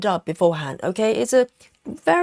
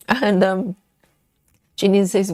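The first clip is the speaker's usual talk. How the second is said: ordinary delivery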